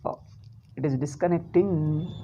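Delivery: usual speaking voice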